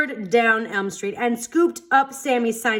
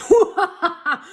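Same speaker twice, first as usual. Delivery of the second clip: loud, higher booming monster laugh; 9 semitones higher, 7 dB louder than usual. A narrator's reading. The monster at the kids, a booming laugh. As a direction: let out a mocking giant laugh. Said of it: "looked down at them and laughed".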